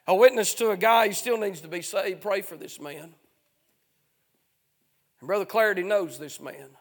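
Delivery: normal talking voice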